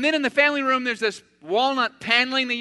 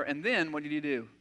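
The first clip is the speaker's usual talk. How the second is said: ordinary talk, nothing marked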